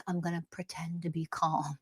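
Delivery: hushed, fake-calm sneer; mock fake calm. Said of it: "Not this fake".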